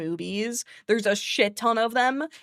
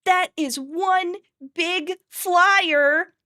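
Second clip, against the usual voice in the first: slow, high cartoon dinosaur voice; drawn-out, wide-eyed kid dinosaur; slow high awestruck cartoon voice; Triceratops quote; 9 semitones higher, 3 dB louder, much slower than usual. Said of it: "Sarah, the Triceratops, goes".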